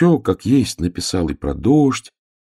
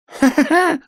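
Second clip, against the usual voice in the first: very high staccato ha-ha-ha; acted laughter; 4 dB louder than usual. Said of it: "расхохотался ёжик". (высокий наигранный хохот)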